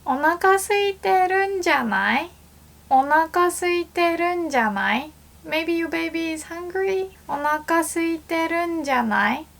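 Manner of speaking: high, gentle reassuring guess. Shown as a demonstration. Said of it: "You wanna say".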